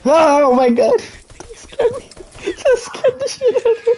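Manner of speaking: high, loud startled laughing shriek. Real, not acted. Friend startles him.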